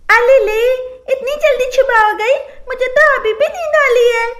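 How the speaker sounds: put-on high lisping baby talk; lisping childish voice. Bride waking up, lisping in a high babyish voice. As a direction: play a lisping young bride. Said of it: "वो तोतली है".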